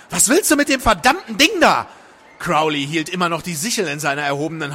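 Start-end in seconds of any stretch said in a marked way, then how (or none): 0.1-1.9 high, loud and agitated; alarmed, angry demand. A narrator's reading. Woman to man holding a sickle, angry alarm.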